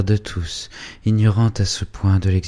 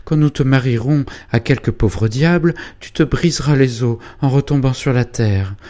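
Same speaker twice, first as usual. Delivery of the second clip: alarmed scolding; 8 semitones higher, 5 dB louder than usual. Acted. Frightened mother scolds daughter loudly.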